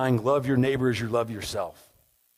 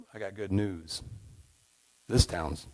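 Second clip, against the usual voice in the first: calm pastor voice; 4 semitones lower, 7 dB quieter than usual. Acted. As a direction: deliver the verdict like a calm, wise pastor. Pastor answers second couple.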